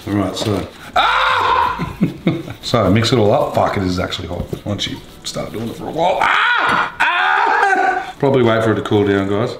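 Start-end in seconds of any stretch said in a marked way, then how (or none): 1.0-2.0 very high short scream; pained yelp. Real, not acted. Cook burnt by the hot mix, high pained yelp. 6.2-8.1 two very high screams; pained yelps. Real, not acted. Cook whose hands meet hot mix, high pained yelps.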